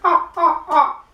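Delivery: high, loud barking "ah ah ah" like a seal; seal bark laugh. Shown as a demonstration. Teacher shows another wrong laugh, a seal bark. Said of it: "or like this".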